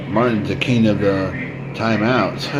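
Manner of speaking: ordinary delivery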